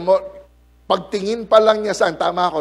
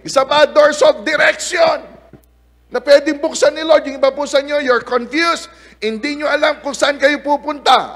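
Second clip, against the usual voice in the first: louder, higher preaching shout; 9 semitones higher, 5 dB louder than usual. Acted. Pastor announcing doors of direction to congregation, preaching shout. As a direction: call it out to the whole hall.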